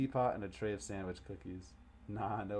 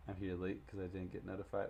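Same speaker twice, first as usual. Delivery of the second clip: usual speaking voice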